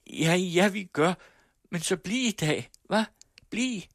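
cling to him with every word; desperate pleading girl. Acted.

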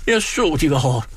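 a flustered landlady wailing at the pot; flustered hostess. Acted. Frantic hostess fussing at the stove that won't boil. Fretting hostess over the potatoes.

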